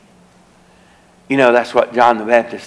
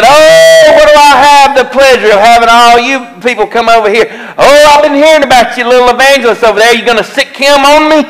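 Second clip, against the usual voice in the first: put-on loud, high, hostile shouting as the drunk neighbour; drunk man ranting; 11 semitones higher, 7 dB louder than usual. Drunk neighbour yelling at the visiting preachers, loud hostile mockery. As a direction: bellow at them like a belligerent drunk mocking visitors.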